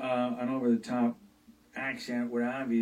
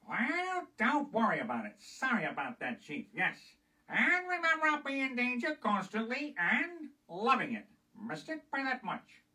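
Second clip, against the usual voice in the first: high nasal spy voice, much higher and quick; Get Smart agent impression; 11 semitones higher than usual. Comedian imitates the Get Smart spy in a much higher voice. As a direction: squeak out the spy lines well above your own voice. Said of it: "Remember Diane Adams of Get Smart".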